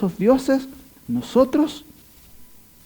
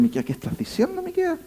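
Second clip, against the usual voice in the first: much higher, pleading disbelief; incredulous protest; 12 semitones higher than usual. Retold or imitated. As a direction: cry out like a shocked contemporary. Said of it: "muchos dijeron".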